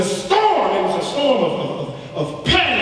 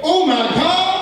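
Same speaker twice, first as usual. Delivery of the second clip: mock alarm; 10 semitones higher, 3 dB louder, slower than usual. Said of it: "God is not up there in heaven saying".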